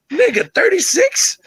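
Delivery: high, drawn-out disbelief; real high-pitched astonishment; incredulous. Real, not acted. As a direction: balk at the number, voice leaping up. Disbelief at the death count.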